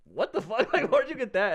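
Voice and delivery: high, loud disbelieving "what the fuck"; shocked. Retold or imitated. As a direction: react in stunned disbelief. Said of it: "the guy was like".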